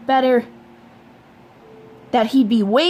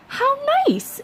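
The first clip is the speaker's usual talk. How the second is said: high, sugary "how nice"; mom's bright mock-pleased reply; mother's voice. A narrator's reading. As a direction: lift it way up, sweetly.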